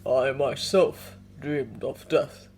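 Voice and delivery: slow, deep, solemn bear; low and grave like an old bear. Acted. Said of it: "came Yorick's deep voice".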